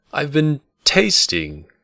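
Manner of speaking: "tasting" said high, loud and slow; emphasizing the word. Acted. Bast correcting the innkeeper, stress on "tasting". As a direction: lean hard on "tasting" to correct him. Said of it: "emphasizing the word".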